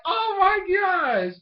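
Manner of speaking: high, drawn-out cry of astonishment; hammed-up disbelief. Reviewer reacting to the matchmaking, exaggerated disbelief. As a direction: wail it slowly and loudly in mock shock. Retelling own amused disbelief.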